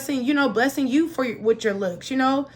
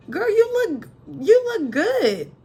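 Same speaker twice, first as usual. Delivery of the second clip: excited hype; 9 semitones higher, 3 dB louder, slower than usual. Real, not acted. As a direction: gush it out, slow and high. Hyping up the viewer.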